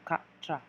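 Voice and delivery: quatre said katr, T and R run together at the end; French quatre. Shown as a demonstration. Teacher drilling four in French: quatre as katr. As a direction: quatre with a hard katr ending. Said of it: "let's try that again".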